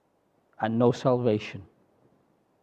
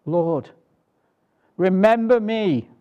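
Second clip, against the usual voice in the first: thief crying out; 5 semitones higher, 5 dB louder, slower than usual. Acted. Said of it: "And he does".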